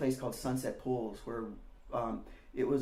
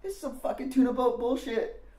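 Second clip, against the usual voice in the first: mocking Randy; 11 semitones higher than usual. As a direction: sneer at the music like a punk older kid. Randy insults the tape.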